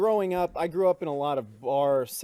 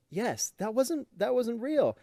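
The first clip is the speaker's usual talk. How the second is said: higher-pitched, earnest mock reassurance; insistent reassurance; 8 semitones higher, slower than usual. Comedian insisting to a crying child, higher reassurance. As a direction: imitate someone hastily reassuring a child.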